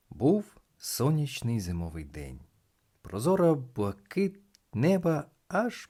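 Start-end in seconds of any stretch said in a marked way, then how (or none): none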